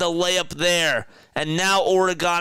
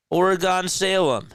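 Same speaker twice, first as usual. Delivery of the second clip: ordinary delivery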